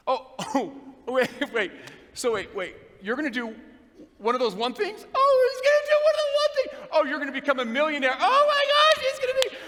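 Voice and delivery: high, loud mocking laughter voice; mocking church guys. Church guys jeering at her new business, mock high squeal.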